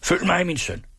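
high, urgent narrated cry; called out. A narrator's reading. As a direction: call out a command, pitched well up. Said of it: "Han sprang op og råbte".

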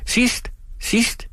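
very high, breathy playful cry; childish game call. A narrator's reading. Daughter replays her childhood game with father, high playful cry. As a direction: squeal it like a child's tag game. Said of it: "råbte hun".

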